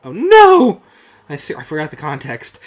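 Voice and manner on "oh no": very high, loud hammed-up shriek; mock scream. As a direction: scream it like a horror heroine. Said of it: "I screamed".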